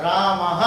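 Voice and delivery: रामः with the final sound voiced as "ha"; रामः said as "raa-ma-ha"; visarga as ha. Shown as a demonstration. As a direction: end रामः on a clear "ha". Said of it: "इसका उच्चारण ह की तरह हो रहा है".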